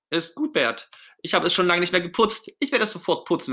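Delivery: high, placating character voice; Ernie, agreeable. Acted. Ernie promising Bert to clean his bike, placating. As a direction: concede in a high voice, promising to fix it.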